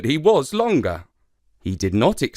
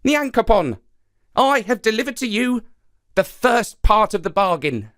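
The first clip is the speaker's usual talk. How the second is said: high, raised spider voice; Anansi calling up; 9 semitones higher than usual. Acted. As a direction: call up to the sky proudly. Anansi calls to sky god.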